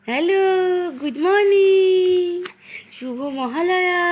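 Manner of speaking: put-on high, very slow baby talk; baby voice for daughter. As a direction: speak for the baby in a tiny voice. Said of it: "বলো".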